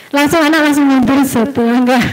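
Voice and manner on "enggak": ordinary talk, nothing marked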